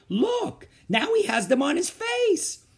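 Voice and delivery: teacher alarmed, crying out high. Acted. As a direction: point it out in shock. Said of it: "she cried".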